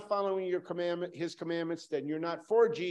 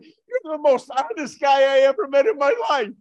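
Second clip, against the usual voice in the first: delighted laughing exclamation; 10 semitones higher, 14 dB louder than usual. Retold or imitated. Retelling delighted reply to friend.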